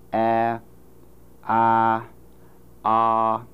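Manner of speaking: "A, ah, ah" as three steady vowels, front to back; vowels five, six, seven. Shown as a demonstration. Phonetician repeating vowel series, "A, ah, ah" moving backward. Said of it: "let me repeat five, six, seven".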